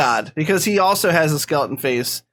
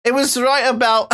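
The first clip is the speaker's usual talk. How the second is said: high, hammed-up English accent; shaky attempt at English accent; 6 semitones higher than usual. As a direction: stumble through a fake English accent. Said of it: "cunty English accent throughout this entire bit".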